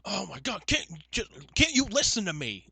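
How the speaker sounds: high, stammering whiny outburst; mock exasperated caller. Caller asked to repeat a number, hammed-up exasperation. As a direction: splutter it as an over-acted complaint.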